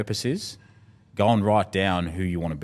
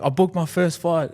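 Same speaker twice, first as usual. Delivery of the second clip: high, loud, bursting with news; excited kid; 11 semitones higher, 5 dB louder than usual. Retold or imitated. Kid tells his coach he booked a fight, high and thrilled. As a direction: blurt it out like a proud young boxer.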